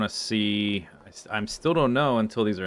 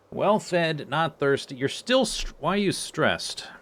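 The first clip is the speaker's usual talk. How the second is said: cooing at pets; 7 semitones higher, slower than usual. Real, not acted. Fussing over the pigs.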